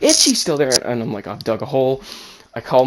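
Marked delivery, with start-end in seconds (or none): 0.0-0.7 higher, louder mock demand; imitated demanding mom. Mom demands to know about sister, imitated outburst. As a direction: press for an answer like an upset mother.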